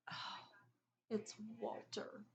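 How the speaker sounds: soft, slow sighing words; sighed. A narrator's reading. Champ spotting Walter at the door, quiet sigh. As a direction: let it out on a tired sigh. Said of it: "he sighed".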